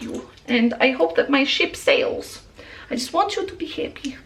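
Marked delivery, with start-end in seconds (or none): 2.9-4.2 soft, breathy whisper of a wish; tender whisper. Real, not acted. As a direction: whisper it gently to a friend.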